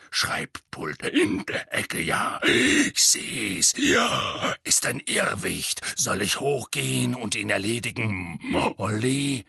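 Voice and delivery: slow rasping auror voice; half-whispered gruff rasp; growled warning. Acted.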